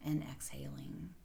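soft, even meditation voice with long pauses; guided meditation. Acted. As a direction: softly guide the listener into settling and breathing.

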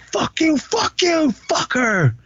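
very high, squeaky imitated cursing; imitating a drunk friend yelling curses; mock drunk cursing. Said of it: "Cleo's like".

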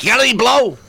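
high-pitched mimicked stripper; stripper's stock answer. Stripper asked about the weather, high mimicked stock answer. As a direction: toss out the same stock line, pitched high.

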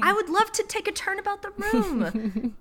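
high, dainty period lady; high prim lady voice. Pretend. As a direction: swoon like a Regency lady.

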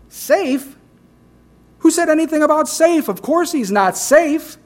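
high, fast indignant beaver voice; excited Mister Beaver. Retold or imitated. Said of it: "Mister Beaver".

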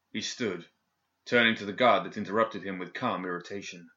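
ordinary delivery

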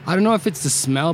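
ordinary delivery

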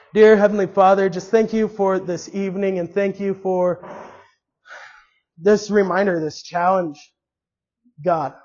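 earnest, halting spoken prayer; closing prayer. Acted. Pastor closing the service in prayer for the congregation, earnest. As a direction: pray it sincerely, pausing between phrases.

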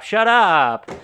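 full mock shout. Cops silencing the ranting Kelly with a loud shout. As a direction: blast the second shut up as a climax. Retold movie argument escalates.

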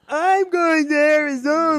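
slow, drawn-out mock impersonation; Tim. Co-host demonstrates how the host sounds, slow mocking impression. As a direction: stretch the words out in caricature. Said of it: "I think he sounds like".

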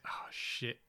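sinking realisation. Retold or imitated. Acting out sudden realisation of mistake.

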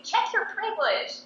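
high, slow mock of the activist's catchphrase; mocking quote. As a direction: squeak it out slowly to ridicule her.

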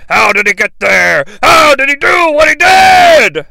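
frantic high-pitched shouting commentator; imitating a ringside announcer's astonished bellowing; Vince. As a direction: yell in disbelief like an announcer losing it. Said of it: "Luckily, Vince keys me in".

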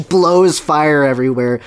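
ordinary talk, nothing marked

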